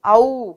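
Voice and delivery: औ loud and stretched, open au glide; long stressed au. Shown as a demonstration. Teacher to class: forceful long au vowel. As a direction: hit औ hard and hold it long. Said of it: "जोर दे करके और लंबा बोला जाता है".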